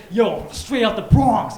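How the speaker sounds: slow, swaggering street tough; tough Bronx guy. Retold or imitated. Comic doing a Bronx tough guy, slow swagger. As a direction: puff up like a Bronx hard man.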